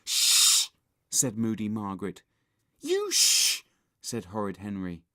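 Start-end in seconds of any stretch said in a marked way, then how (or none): 0.0-0.7 loud, hissed shushing; sharp shush. A narrator's reading. Girl silencing scared boy, loud shush. 2.8-3.6 very high, loud shush back; indignant retort. A narrator's reading. Boy shushing the girl back, indignant.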